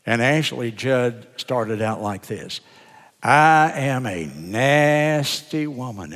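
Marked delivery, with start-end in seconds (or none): 3.2-6.1 slow, stretched-out NASTY; drawn-out nasty. Retold or imitated. Pastor imitates Ashley Judd's reading to his church, drawn-out nasty. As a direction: draw "nasty" out long, imitating a speaker's emphasis. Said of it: "she emphasized, drew out the nasty".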